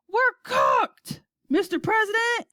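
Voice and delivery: high, loud despair; shouting. A narrator's reading. Astronaut telling the president it's hopeless, shouting. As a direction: shout it as if all is lost. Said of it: "shouted Shanks".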